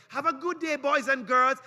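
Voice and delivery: high, loud, sing-song teacher giving homework; classroom teacher voice. Retold or imitated. Teacher dismissing his pupils with homework, high and loud. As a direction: call out instructions to the kids at the bell.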